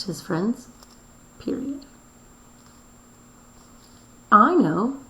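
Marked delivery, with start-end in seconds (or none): 4.3-5.0 loud excited exclaim. Shown as a demonstration. Teacher reading Helen's line to the class, loud exclaim. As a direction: read it like she's exclaiming. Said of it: "exclaimed Helen".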